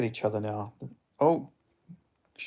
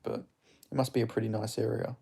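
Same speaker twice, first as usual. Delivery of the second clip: ordinary talk, nothing marked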